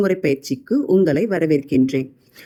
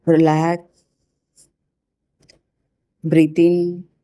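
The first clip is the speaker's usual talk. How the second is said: spa whisper. Acted. Leads a relaxation meditation.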